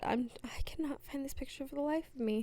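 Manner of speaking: whispered to herself while searching; muttered aside. Real, not acted. Searching her phone for the photo, whispered aside. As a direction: mutter under your breath, half to yourself.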